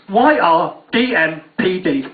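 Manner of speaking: each letter spelled out slowly and separately. Shown as a demonstration. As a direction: read the letters one by one, slowly. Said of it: "a text that says".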